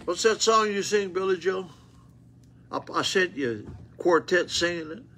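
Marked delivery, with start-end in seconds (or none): none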